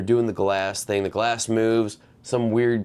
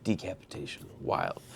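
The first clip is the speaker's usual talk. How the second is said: whispering; 3 semitones lower, 13 dB quieter than usual. Real, not acted. Reacting to gory scene.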